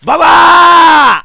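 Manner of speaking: high, drawn-out yell; long shout. Real, not acted. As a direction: stretch out a loud startled yell. Old woman appears, yells at it.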